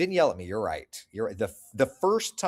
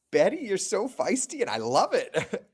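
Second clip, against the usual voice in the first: laughing; 9 semitones higher, 8 dB louder than usual. Real, not acted. Laughs at feisty comment.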